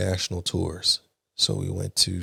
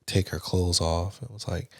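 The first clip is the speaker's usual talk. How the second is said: ordinary talk, nothing marked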